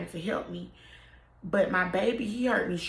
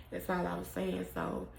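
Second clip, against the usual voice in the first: ordinary talk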